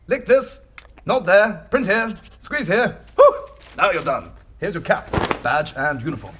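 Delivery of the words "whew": relieved whew. Acted. Relief sound.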